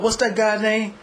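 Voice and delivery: normal talking voice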